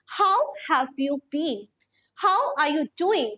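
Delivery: each word spaced out, slow and clear; slow model sentences. Shown as a demonstration. Teacher modelling phrases for students, slow and clear. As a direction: read it slowly so students can copy. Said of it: "teacher models sentences slowly".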